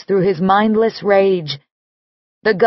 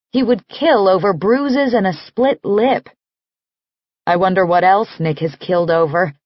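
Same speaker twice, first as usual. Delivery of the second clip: ordinary delivery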